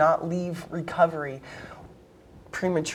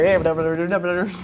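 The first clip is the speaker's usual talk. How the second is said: auctioneer; 4 dB louder than usual. Shown as a demonstration. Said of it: "sound like an auctioneer".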